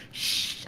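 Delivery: sharp unvoiced shush. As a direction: hush someone fast. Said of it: "Mom was like".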